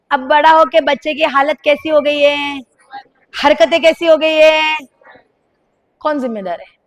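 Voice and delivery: ordinary talk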